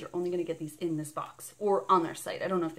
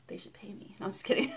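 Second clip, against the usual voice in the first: laughing aside; 4 dB quieter, faster than usual. Real, not acted. Joking about her song.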